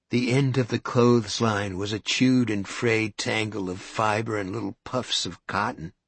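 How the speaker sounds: ordinary delivery, nothing marked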